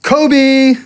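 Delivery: high, loud call of Kobe as he shoots; shouted Kobe. Retold or imitated. Throwing the balled-up letter at the bin, high loud shout. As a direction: yell it like a buzzer-beater shot.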